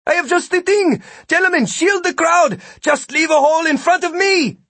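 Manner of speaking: high, loud shout. A narrator's reading. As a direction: call out to the crowd. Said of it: "Vikram shouted".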